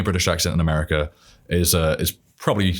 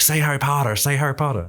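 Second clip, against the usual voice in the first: higher-pitched mock American pestering, POTTER as PAH-der; eager imitation of American schoolkids, pitched up; imitating American fans; 7 semitones higher than usual. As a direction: egg someone on in a put-on American accent. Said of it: "try to get me to say Harry Potter".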